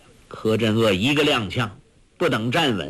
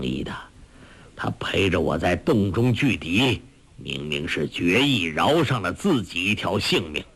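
low-voiced old martial arts master; old beggar master; 6 semitones lower than usual. Old beggar master recounting the cave fight to his disciple, low and earnest. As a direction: tell it low, as a seasoned elder.

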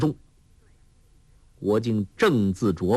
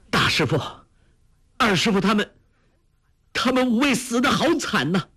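higher, tear-choked calling out to his teachers; tearful cry; 12 semitones higher, faster than usual. A narrator's reading. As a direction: cry out through tears. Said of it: "随即眼泪流了下来，叫道". (含泪高声哭喊)

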